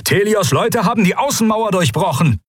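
high, urgent guard's report; alarmed report. A narrator's reading. Guardsman announcing the breached wall, high alarmed report. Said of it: "meldete einer der Gardisten".

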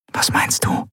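breathy secret whisper; whispered back. Acted. Endriel whispering to Keru in the cell. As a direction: whisper it so the guard can't hear. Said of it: "flüsterte Endriel zurück".

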